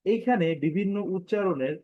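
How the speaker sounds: normal talking voice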